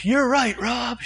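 high-pitched imagined cheer of agreement; mock eager agreement. Congregation imagined agreeing after his preaching, mock eager. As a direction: imitate people suddenly won over.

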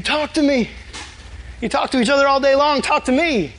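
high, insistent plea as God; urgent appeal. Acted. God voiced urging people to pray.

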